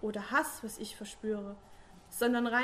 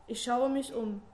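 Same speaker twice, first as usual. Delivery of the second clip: usual speaking voice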